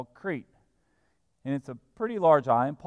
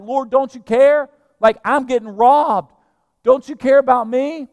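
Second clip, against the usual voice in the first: loud, high-pitched aggrieved plea; indignant Martha complaining; 10 semitones higher, 11 dB louder than usual. Acted. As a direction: act out a sister pleading her grievance. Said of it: "she even speaking bad about her sister".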